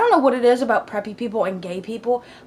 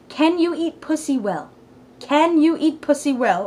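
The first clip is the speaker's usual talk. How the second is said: baffled rereading; 5 semitones higher than usual. Retold or imitated. Rereading a baffling text in confusion.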